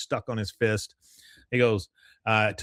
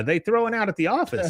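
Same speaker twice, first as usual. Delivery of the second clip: Con's voice; 10 semitones higher than usual. Retold or imitated. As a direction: brag it up high.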